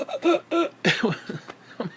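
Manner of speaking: short high laugh breaks out; nervous laugh. Real, not acted. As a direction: laugh off the fright. Nervous laugh after panic story.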